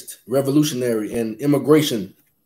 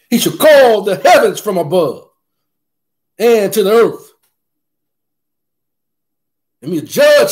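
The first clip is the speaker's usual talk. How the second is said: higher and louder, preaching with fire; fiery scripture reading; 8 semitones higher, 5 dB louder than usual. Acted. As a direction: give the psalm real fire, thundering. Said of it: "he wants you to have a little fire in your voice".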